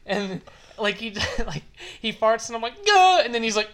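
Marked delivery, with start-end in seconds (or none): 2.9-3.2 a sudden high, loud "Gah!" of disgust; disgusted yelp. Retold or imitated. Retold disgusted yelp. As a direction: friend farts on him while pinned, he yelps high and loud.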